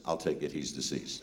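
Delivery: low, quiet matter-of-fact punchline; understated, solemn nerve; deadpan. Retold or imitated. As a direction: drop it softly and deep, totally unfazed. Herbie's deadpan punchline at graduation.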